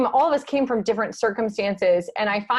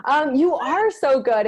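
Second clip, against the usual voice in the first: gushing; 4 semitones higher, slower than usual. Real, not acted. Host gushing back at guest.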